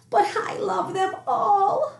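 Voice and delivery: put-on high, slow, pleading little boy; crying out. A narrator's reading. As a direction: wail it as a desperate child. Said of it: "Spencer cried".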